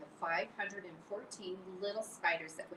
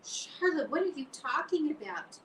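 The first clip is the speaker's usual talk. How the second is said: high, louder alarmed cry; panicked, high cry; 10 semitones higher, 5 dB louder than usual. Acted. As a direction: Wilbur cries out at his dying friend. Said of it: "he cried".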